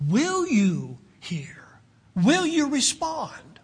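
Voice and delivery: drawn-out, pleading questions; slow down and plead for an answer; earnest appeal. Retold or imitated. Preacher offering sinners the opportunity, slow earnest appeal.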